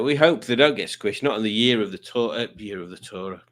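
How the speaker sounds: normal talking voice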